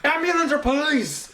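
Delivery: high, mimicked emergency operator; stressed-out dispatcher. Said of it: "like, fucking stressed out".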